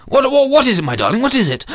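high, stuttering concern; flustered husband. Acted. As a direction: fuss over a panicking wife. Husband answers panicking wife.

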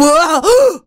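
high startled gasp; sharp gasp. Acted. Giant jolts awake with a high startled gasp. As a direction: catch the breath in alarm.

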